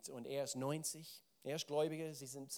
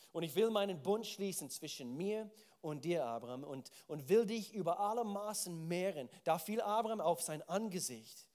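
scripture reading; 3 semitones higher, 6 dB louder than usual. Acted. Reads God's words in Genesis.

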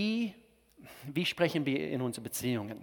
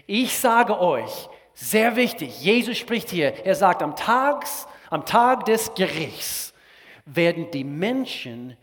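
slow, higher, louder preaching; 4 semitones higher, 5 dB louder, slower than usual. Acted. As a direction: drive it home from the pulpit. Pastor preaches at full force.

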